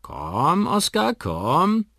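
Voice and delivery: hoch und sehr langsam gelockt; lockend. A narrator's reading. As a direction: lock den Vogel sanft zu dir. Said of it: "lockt Molly". (Molly lockt den Kakadu, gedehnt und hoch)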